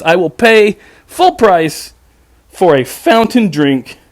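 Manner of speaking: slow, spelled-out pleading; exaggerated patience. Customer to drive-thru kid, slow exasperated patience. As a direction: drag it out like talking to a child. Exasperated ordering, slowed right down.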